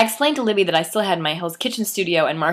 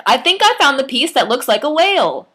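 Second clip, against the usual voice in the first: loud, high excited shout; 8 semitones higher, 7 dB louder than usual. A narrator's reading. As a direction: call it out across the room, thrilled. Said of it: "me shouting things like".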